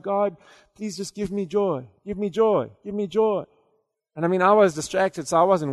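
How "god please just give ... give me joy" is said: ordinary delivery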